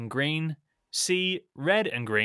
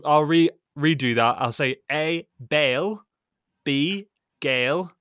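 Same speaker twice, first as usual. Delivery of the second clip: hammed-up English accent, much louder; 4 semitones higher, 8 dB louder than usual. Scottish host redoes the quiz options in an English accent for Alex. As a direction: read the options in an exaggerated posh English accent. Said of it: "flippin' English accent".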